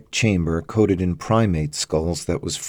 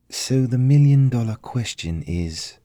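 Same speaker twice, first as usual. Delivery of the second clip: higher pitch, held for suspense; dramatic effect; 6 semitones higher than usual. A narrator's reading. Elizabeth puzzling over the find with Damien, dramatic build-up. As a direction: set up the big question, then pause. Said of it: "Elizabeth paused for dramatic effect".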